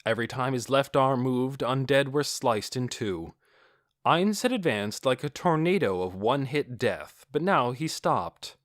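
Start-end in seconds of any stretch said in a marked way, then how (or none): none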